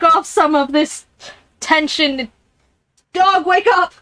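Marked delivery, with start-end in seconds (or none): 3.1-3.9 calling the dog. Real, not acted. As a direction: shout up to the sleeping dog.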